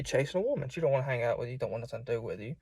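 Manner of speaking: usual speaking voice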